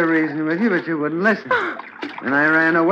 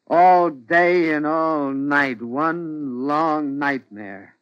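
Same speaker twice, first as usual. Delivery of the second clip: slow, drawn-out brooding; weary lament; much slower than usual. Acted. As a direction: draw out every word in weary misery. Husband weary of his nightmares.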